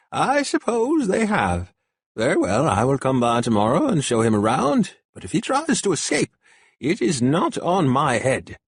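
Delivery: a tired adviser agreeing under protest; wary old counselor. A narrator's reading. Burkhard voiced throughout.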